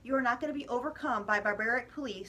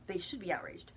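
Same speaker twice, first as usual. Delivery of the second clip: mock the media in a hushed whisper; 6 semitones lower, 6 dB quieter than usual. The media acting scandalized, whispered mockingly. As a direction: breathe it like a fake scandal. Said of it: "the media is like".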